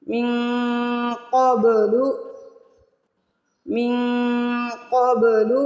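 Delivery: teacher repeating من قبل for the class; qalqalah demo. Shown as a demonstration. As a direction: punch the ba in قبل. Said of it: "با کو ہلا کے ادا کریں گے".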